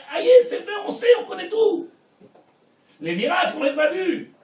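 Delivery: fiery sermon shout; exclaimed. Real, not acted. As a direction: burst out in exasperation. Preacher's mocking outburst.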